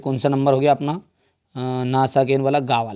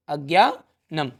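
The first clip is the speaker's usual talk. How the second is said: अज्ञानम slowly, broken into drawn-out parts; slow dictation; much slower than usual. Real, not acted. Dictates the answer while writing it. (अज्ञानम को तोड़कर, धीरे लिखवाते हुए)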